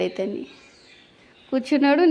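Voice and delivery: normal talking voice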